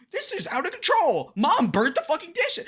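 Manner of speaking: high, loud tattletale whine; mock bitchy whine. Reactor mocks candidate as a nagging complainer, shrill whiny outburst. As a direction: blow it up into a shrieking complaint. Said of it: "Again, bitchy attitude".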